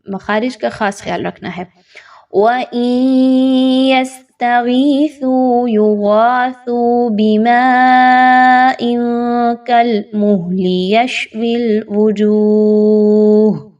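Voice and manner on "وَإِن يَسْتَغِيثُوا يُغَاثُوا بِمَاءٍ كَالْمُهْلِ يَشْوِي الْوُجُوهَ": slow melodic tajweed chant, long held vowels; Quranic recitation. Shown as a demonstration. Teacher reciting the verse to the class, melodic chant. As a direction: chant it slowly and evenly.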